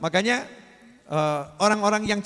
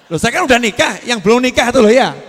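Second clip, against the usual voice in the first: louder, higher mock protest; joking protest; 4 semitones higher, 9 dB louder than usual. Preacher to the audience, a loud playful protest. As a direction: push back laughing at the crowd.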